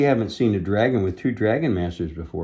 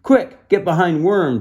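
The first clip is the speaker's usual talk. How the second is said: urgent call, louder and higher; urgent yell to the friends behind the dragon; yelled warning; 9 semitones higher, 8 dB louder than usual. Acted. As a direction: shout it to your friends to take cover. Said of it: "Drake yelled to his friends".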